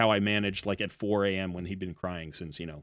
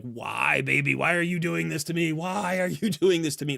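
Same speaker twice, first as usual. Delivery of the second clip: put-on higher, pleading why at a crying baby; half-laughing mock despair, pitched up; exasperated plea to baby; 6 semitones higher than usual. Tired dad pleading with crying baby, mock despair. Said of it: "you're just like".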